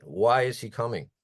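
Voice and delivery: ordinary delivery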